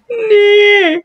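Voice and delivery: high frightened squeak of a nah; scared. Real, not acted. Vlogger recoiling from a scary maze prop, squeaky frightened nah. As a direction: yelp it out in fright.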